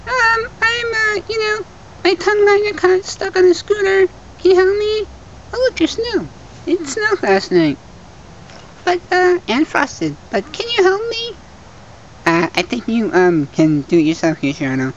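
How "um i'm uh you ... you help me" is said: very high-pitched cartoon voice; high squeaky voice. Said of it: "I'm still having this voice".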